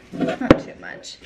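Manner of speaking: ordinary talk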